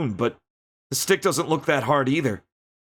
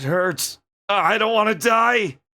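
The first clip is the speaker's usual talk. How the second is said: high, louder desperate plea; desperate cry; 7 semitones higher, 3 dB louder, slower than usual. Acted. Wounded Billy crying out to the camera, desperate. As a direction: cry out, begging not to die.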